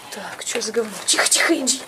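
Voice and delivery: tense low whisper; whispering while hiding. Real, not acted. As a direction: whisper so he won't hear. (шёпотом, прячась)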